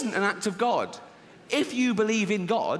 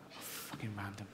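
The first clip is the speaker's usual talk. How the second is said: muttered aside; 9 semitones lower, 18 dB quieter than usual. Pretend. Muttered aside after laughing.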